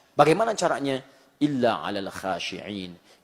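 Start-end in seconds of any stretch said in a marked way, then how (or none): 1.4-3.2 Quranic recitation. Acted. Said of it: "quoting verse".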